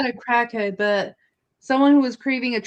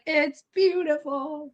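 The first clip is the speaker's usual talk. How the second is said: slow, higher, mock-awed cartoon voice; Tabitha as Squidward; 5 semitones higher, 4 dB quieter, slower than usual. Teasing Tabitha as the Squidward of the story, mock awe. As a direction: draw it out in imitated awe. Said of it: "And now Tabitha's like".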